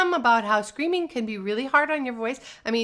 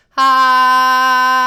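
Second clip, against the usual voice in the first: loud, squeezed ha with the chin reached out; chin-out squished ha; 4 semitones higher, 15 dB louder than usual. Shown as a demonstration. Voice teacher showing students how reaching the chin out squeezes the voice. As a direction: push out a strained ha, chin forward. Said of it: "reach my chin out and listen".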